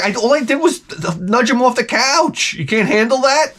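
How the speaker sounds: high, defensive protest; mock defensive. Owner defending a little nudge to the dog, high and defensive. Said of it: "You're like".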